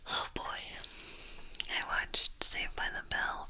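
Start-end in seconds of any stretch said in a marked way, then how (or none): none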